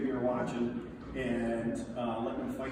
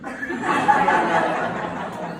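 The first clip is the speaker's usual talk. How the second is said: loud open laugh at his own story; a big real laugh over the story; 4 semitones higher, 10 dB louder than usual. Real, not acted. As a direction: burst out laughing.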